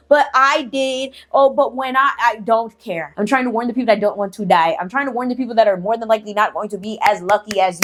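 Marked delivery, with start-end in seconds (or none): 0.1-2.1 slow, whiny mock protest; mock whiny objection. Mocking viewers who argue back, slow whiny tone. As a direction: drag it out like a petulant excuse. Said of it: "So stop with the".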